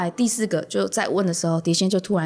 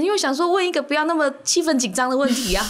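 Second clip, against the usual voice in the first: ordinary talk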